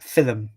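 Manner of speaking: low, deliberate two-syllable "Film"; "Film" as fil-um; mispronunciation of film. Retold or imitated. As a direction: say "Film" with an extra vowel before the m, fil-um. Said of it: "I don't think he says it like that".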